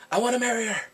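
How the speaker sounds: high, loud announcement; loud declaration. Retold or imitated. Jongseok tells everybody, loud mock declaration. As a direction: proclaim it to the whole room.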